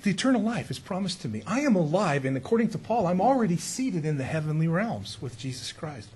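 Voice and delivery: normal talking voice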